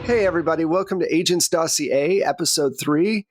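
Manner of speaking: normal talking voice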